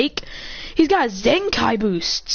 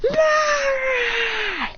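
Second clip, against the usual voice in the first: long, high ape howl; great ape cry. Acted.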